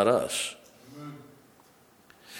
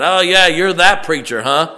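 loud, higher jeering voice; mocking townsperson; 5 semitones higher, 9 dB louder than usual. Scoffer taunting the preacher, mock jeering voice. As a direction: sneer at the preacher like a heckler.

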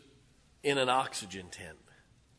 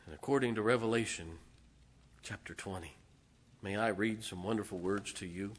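ordinary delivery

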